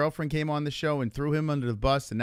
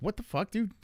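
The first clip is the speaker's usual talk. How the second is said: high-pitched retold astonishment; mock disbelief; 9 semitones higher than usual. Retelling finding out about the friendship, incredulous. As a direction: play up being amazed, pitched up.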